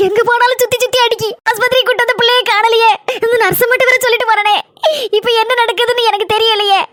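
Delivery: weepy, distraught pleading; tearful wailing. Acted. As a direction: wail helplessly through acted sobs. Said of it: "அக்கா, அழாதீங்கக்கா".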